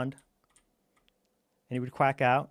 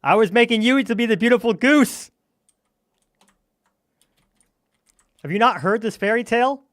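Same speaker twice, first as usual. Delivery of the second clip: indignant; 7 semitones higher, 9 dB louder than usual. Real, not acted. Scolding the other player.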